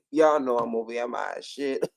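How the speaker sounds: usual speaking voice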